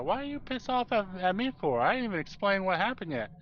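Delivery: acting out a puzzled, defensive complaint; retold protest. Retold argument with the angry boss.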